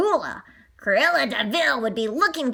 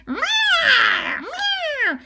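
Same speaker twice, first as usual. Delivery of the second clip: distressed meows; 14 semitones higher than usual. Acted. Cat too small to protect.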